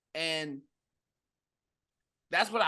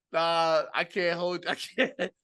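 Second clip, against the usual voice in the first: can't stop laughing through the words; helpless giggles, barely getting words out; real laughter; 3 semitones higher, 6 dB louder than usual. Real, not acted. Host laughing too hard to talk about the face.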